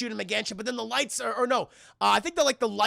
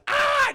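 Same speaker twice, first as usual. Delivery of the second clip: short, high, loud yelp; mock pain scream; 7 dB louder than usual. Streamer as Billy shot with crossbow bolts, mock scream. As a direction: scream like you've been hit.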